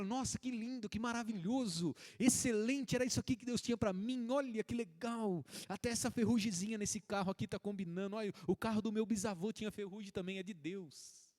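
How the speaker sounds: high, gushing mock delight over a rusty car; mock gushing buyer. Pastor mimics a buyer gushing over a rusty car, high and excited. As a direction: play the dazzled buyer who sees only blessings.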